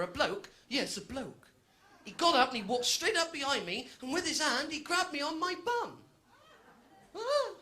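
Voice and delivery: ordinary talk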